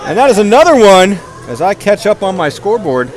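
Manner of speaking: lively hockey commentary; play-by-play. Acted. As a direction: call the game as it happens.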